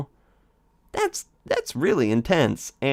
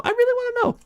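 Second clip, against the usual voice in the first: even higher, strained squeak; squeaky high plea; faster than usual. Acted. Speaker pleading with Alphys, squeaky high. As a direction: push it up into a squeak.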